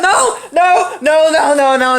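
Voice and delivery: mildly panicked stream of no's; retold alarmed protest. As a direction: protest in mock alarm, tumbling out. Retold alarmed refusal at flashing.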